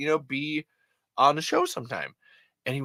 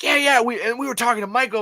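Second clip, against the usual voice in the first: excited friend; 10 semitones higher, faster than usual. Retold or imitated. Dusty quoted, excited.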